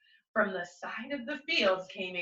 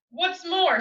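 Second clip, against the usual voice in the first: a higher, louder sharp retort; snapped; 8 semitones higher, 4 dB louder than usual. A narrator's reading. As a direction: snap it back at him, irritated. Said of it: "snapped the Lorax".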